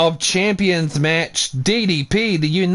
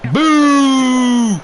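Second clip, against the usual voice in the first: booing; 3 semitones higher than usual. Real, not acted. Booing the finish.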